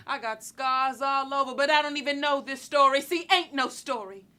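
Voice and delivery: loud insistence. Acted. Woman insisting her scars have no story, loud and emphatic. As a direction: push it out hard, insisting. Repeated line breaks out.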